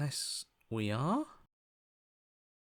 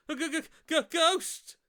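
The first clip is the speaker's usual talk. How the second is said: stammering high shriek of fright; stutter, then scream ghost; panicked squeal at a ghost; terrified stammer; 4 dB louder than usual. Acted. Sees the ghost.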